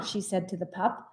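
plain reading voice

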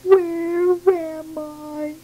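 high, very slow and small; a tiny voice lost and bewildered; lost little bird. Acted. Lost baby bird asks where it is, high and slow.

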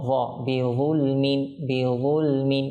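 বিযুলমিন with a heavy, rounded emphatic "dh"; correct heavy Zha. Shown as a demonstration. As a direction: say বিযুলমিন with a full-mouthed Arabic Zha. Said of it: "ঠিকভাবে যেন আমরা উচ্চারণ করি".

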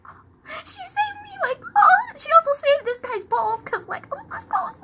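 high, squeaky pretend crying; mock tearful joy. Retelling being saved by the friend, mock tears of joy. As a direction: wail it high as if overcome with relief. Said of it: "cried tears of joy".

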